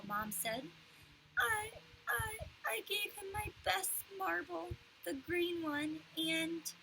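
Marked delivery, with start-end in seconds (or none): 1.4-6.7 put-on crying child. A narrator's reading. Fudge crying confession.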